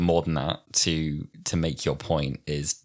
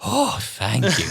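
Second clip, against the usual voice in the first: mock relief; 5 semitones higher, slower than usual. Acting relieved Doctor.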